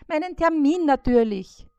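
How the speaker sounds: high, loud fly's call. A narrator's reading. Fly Bissi calls the spider, high and loud. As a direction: shout up to the spider, shrill and small.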